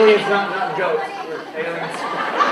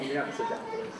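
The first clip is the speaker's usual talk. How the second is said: whispering; 5 semitones lower, 16 dB quieter, much faster than usual. Pretend. Dramatic hushed announcement.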